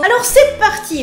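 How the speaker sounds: upbeat let's go. Real, not acted. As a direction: launch it like a show host. Launching the writing part excitedly.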